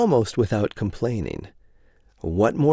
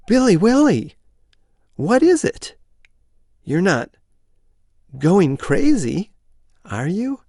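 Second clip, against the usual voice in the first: high, anxious wife voice; worried wife voice; 9 semitones higher, 4 dB louder than usual. Acted. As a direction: fret over him like his wife.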